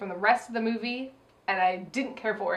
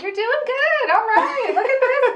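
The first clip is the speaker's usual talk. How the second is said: high, louder cheering-on; mock encouragement; 12 semitones higher, 5 dB louder than usual. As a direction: cheer the film along in a high pitch.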